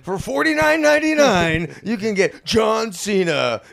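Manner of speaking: high, shouted hype, stretching out the name; wrestling promo announcer. Retold or imitated. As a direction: bellow it like a ring announcer in a prank ad. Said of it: "and they're all".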